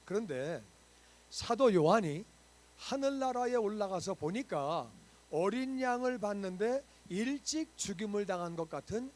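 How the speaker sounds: loud, fervent sermon delivery, pressing each point home; full-force preaching. Acted. Pastor preaching to his church, loud and fervent. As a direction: preach it out to the whole congregation.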